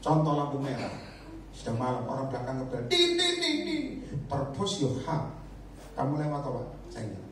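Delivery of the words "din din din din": high honking din din; high mock horn; mock horn honks. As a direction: imitate an impatient car horn. Imitating car horn.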